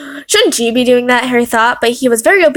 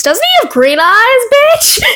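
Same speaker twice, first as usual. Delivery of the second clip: high, loud indignant cry; outraged squeal; 12 semitones higher, 5 dB louder, slower than usual. Real, not acted. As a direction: scream the correction. Outraged at wrong eye colour.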